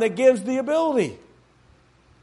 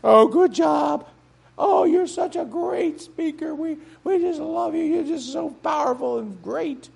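gushing flatterer; 7 semitones higher than usual. Retold or imitated. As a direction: gush over the speaker. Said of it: "go on my back and go".

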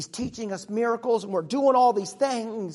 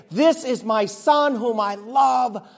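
voice from the thunder; 4 semitones higher, 9 dB louder, slower than usual. Retold or imitated. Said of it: "we heard a voice say".

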